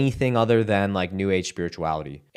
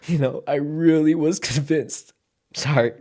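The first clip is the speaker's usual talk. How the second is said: higher voice, choking up for real; pained, emotional; 5 semitones higher than usual. Real, not acted. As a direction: confess it while holding back tears. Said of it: "There's just a lot of pain here".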